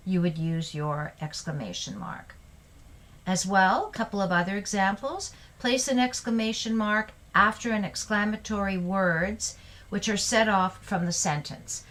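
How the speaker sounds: ordinary talk, nothing marked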